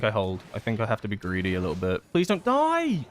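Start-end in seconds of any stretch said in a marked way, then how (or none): 2.1-3.1 panicked begging, pitch shooting up; desperate high plea. Real, not acted. Gamer begging the healer to keep him alive, panicked high squeal. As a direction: squeal it, much higher and louder. Pleading for teammate's survival.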